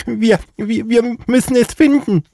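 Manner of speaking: high, stammering little hare; high bunny voice. Acted. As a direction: squeak it nervously like a small hare. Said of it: "sagte die Häsin Lotta".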